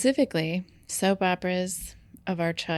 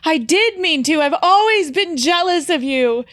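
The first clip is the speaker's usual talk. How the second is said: dramatic outburst; 8 semitones higher, 8 dB louder than usual. Pretend. Mock soap confession.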